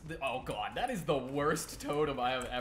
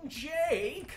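much higher, sing-song taunting of the survivor; teasing high voice; much slower than usual. Pretend. Killer streamer taunting survivor Jake, high sing-song teasing. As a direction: coo at him like a pet you're hunting. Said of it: "All right, I'll stop".

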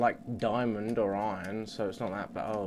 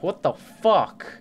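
slow, loud, disbelieving; stunned angry yell; 4 semitones higher, 12 dB louder, much slower than usual. Real, not acted. Killed by a creeper, outraged disbelief. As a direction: drag each word out in outrage.

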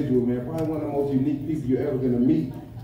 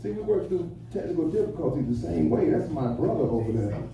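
usual speaking voice

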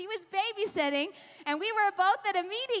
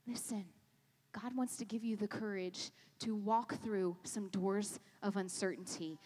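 hushed earnest preaching; 10 semitones lower, 10 dB quieter than usual. Real, not acted. Preacher encouraging the congregation to have courage, hushed.

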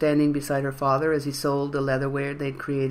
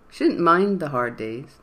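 ordinary delivery

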